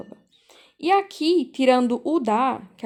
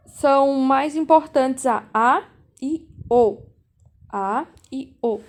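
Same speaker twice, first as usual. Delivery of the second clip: A e O said louder and slowed down, each vowel given weight; teacher drilling the key vowels A e O, pressing each slowly; stressing A and O; 4 dB louder than usual. Shown as a demonstration. Stressed A e O. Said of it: "Vocês têm que lembrar".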